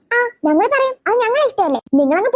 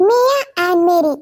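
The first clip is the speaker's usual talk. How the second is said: slow name; much slower than usual. Acted. New girl introduces her name.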